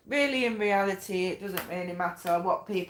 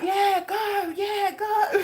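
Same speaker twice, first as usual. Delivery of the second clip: high squealed cheer through laughter; excited cheering; 13 semitones higher, 3 dB louder, much slower than usual. Acted. Spirit team cheering the reader on, excited. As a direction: egg someone on, cracking up. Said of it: "They're dead excited".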